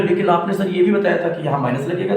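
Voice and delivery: ordinary delivery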